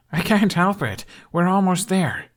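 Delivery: can barely contain the thrill; excited. A narrator's reading. Hiccup nearly at Miklagard, bouncing with excitement. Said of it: "behaving far too excitable".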